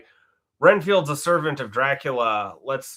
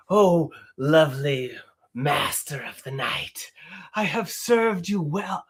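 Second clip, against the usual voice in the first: Renfield; 3 semitones higher than usual. Pretend. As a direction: beg like a groveling lunatic servant. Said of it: "can you do a line or a scene".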